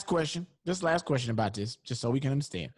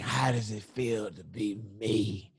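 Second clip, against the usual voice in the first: low, slow, soft quoted line; Eddie Kane; 7 semitones lower, 5 dB quieter, slower than usual. Acted. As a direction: drop low and draw the question out. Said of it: "uh, the Eddie Kane".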